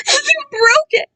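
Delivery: high, slow crying wail; hammed-up sobbing. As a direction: wail it through tears. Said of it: "Kai said between horrible cries".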